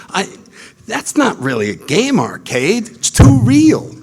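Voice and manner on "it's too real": much higher, louder, slow cry; too real protest. Retold or imitated. He protests to God about life's arcade, high and loud.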